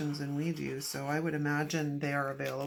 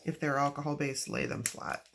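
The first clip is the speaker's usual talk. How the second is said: ordinary talk, nothing marked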